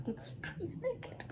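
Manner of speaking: hushed, high, breath-heavy aside; soft, secretive squeak; furtive amused murmur. Acted. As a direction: whisper it like a giggling onlooker. People laughing, murmur to each other.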